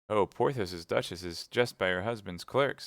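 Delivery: usual speaking voice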